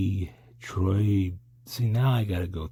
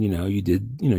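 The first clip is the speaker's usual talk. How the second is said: normal talking voice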